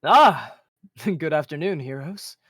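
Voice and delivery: loud, bright hearty greeting; cheerful greeting. Acted. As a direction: greet the heroes with a hearty laugh. Xander greets the party.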